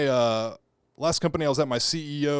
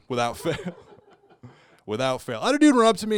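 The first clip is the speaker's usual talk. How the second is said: ordinary delivery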